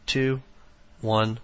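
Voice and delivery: usual speaking voice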